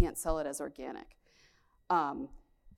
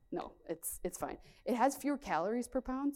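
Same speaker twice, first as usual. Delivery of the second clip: usual speaking voice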